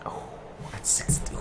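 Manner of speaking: breathless whisper of disbelief; barely voiced, stunned by the number; shocked whisper. Real, not acted. Reacting to the heroine's age in a stunned whisper.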